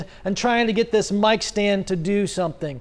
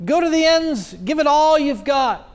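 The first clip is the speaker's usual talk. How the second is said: higher-pitched goading, egging them on; sarcastic challenge, pitched up and pushy; mocking taunt; 5 semitones higher than usual. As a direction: taunt the prophets like a heckling coach. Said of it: "where Elijah says".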